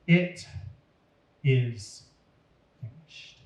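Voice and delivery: ordinary delivery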